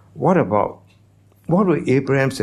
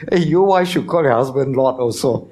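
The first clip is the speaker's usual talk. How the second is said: raised, teasing call to the crowd; higher-pitched jokey admonishment; playful challenge; 4 semitones higher, 4 dB louder than usual. Real, not acted. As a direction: needle the listeners good-humouredly. Jokingly challenges wives in congregation.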